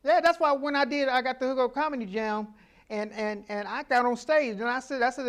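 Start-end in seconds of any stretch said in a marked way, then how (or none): none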